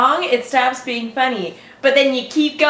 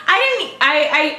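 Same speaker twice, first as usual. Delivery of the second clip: ordinary talk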